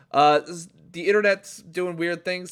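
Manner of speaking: usual speaking voice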